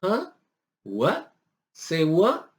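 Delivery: questioning, with rising ends; mock baffled double-take; acted disbelief. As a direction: react as if you can't believe it. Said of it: "you would be like".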